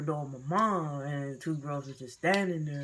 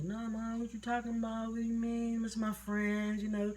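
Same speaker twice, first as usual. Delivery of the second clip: put-on higher, softly protesting kid voice; innocent denial; 6 semitones higher, 3 dB quieter than usual. Child covering for friends to mom, imitated innocent protest. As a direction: play it innocent, pitched up.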